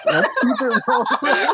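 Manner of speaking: high squeaky laugh through words. Real, not acted. Laughing after the ally joke.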